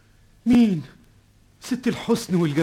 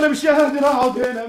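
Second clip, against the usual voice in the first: high, loud, crying panic; rising distraught cry of confusion; panicked outburst; 8 semitones higher, 5 dB louder than usual. Acted. (ذعر باكٍ بصوت عالٍ ومرتفع)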